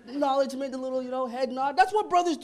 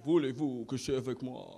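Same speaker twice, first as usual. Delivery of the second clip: very low, soft Parisian French; Parisian French accent; 13 semitones lower, 6 dB quieter than usual. Acted. Comic shows how somebody from Paris would say it, low Parisian French. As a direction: murmur it as a seductive Parisian. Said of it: "that's Parisian French".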